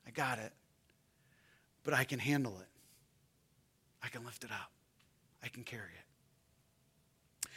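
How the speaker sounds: soft, slowing, tender reassurance; tender voice of Jesus. Acted. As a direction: speak it gently as a comforting savior. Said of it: "He says".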